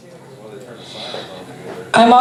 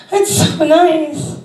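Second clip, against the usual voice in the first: dreamy, touched; 8 semitones higher, much slower than usual. Retold or imitated. Dreamy reply after sigh.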